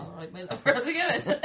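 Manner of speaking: hammed-up Somerset greeting, about to laugh; Somerset accent. Shown as a demonstration. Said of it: "Somerset. Is that the same?".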